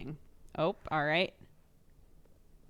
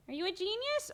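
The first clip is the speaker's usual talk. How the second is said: much higher doting question; 13 semitones higher than usual. Real, not acted. Cooing at the baby.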